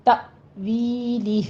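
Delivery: तवीली with a sharp jolt on त and a stretched वी; तवीली recited slowly, त struck firmly; jerk on ता. Shown as a demonstration. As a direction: snap the त of तवीली, then draw out वी. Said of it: "नरमी से तावीली नहीं पढ़ना".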